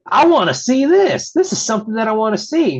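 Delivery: high, loud excited outburst; excited fans. Retold or imitated. Crowd reacting to Eddie Guerrero against Brock Lesnar, excited. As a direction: act out fans hyped for a match.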